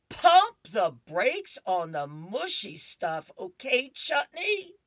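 loud, slow, drawn-out brush-off with a high squeak on the name; a schoolboy putting the brakes on, loudly; loud, slow boy voice with a high squeak at the end; boy brushing her off. Acted.

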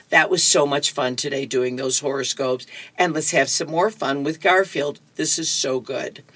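ordinary talk, nothing marked

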